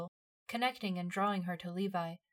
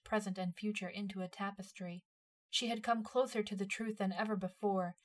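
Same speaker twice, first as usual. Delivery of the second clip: ordinary delivery, nothing marked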